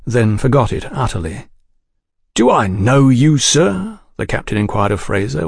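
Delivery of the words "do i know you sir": high, menacingly jolly question; brittle murderous jollity. A narrator's reading. Captain challenging a man he has just recognised, brittle murderous jollity. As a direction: sound cheerful with murder underneath. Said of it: "with a brittle, murderous jollity".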